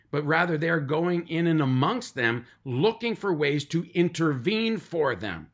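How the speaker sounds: lauter, drängender Predigtton; preaching. Acted. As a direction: steigere dich wie ein Prediger auf der Kanzel. Preacher builds to full force.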